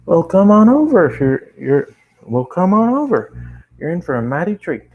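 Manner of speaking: put-on high, drawled country girl invite; Applejack voice. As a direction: sing it out like a proud country pony. Applejack invites him over.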